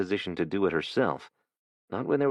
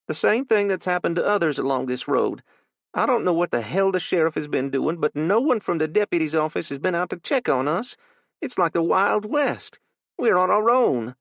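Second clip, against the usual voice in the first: higher, louder woman slowing on the last lines; shaken old woman; 11 semitones higher, 5 dB louder than usual. Acted. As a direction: neighbor venting that they're on their own. Frightened neighbor woman complains.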